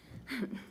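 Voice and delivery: short quiet nervous laugh. Real, not acted. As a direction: laugh off the nerves softly. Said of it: "I'm a little nervous".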